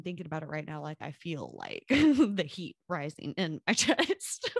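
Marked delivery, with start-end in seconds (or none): 1.6-4.6 laughing. Real, not acted. Laughs while describing rising anger.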